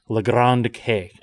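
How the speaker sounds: slow, drawn-out French accent on Grand K. Shown as a demonstration. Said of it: "I'll work on my French accent".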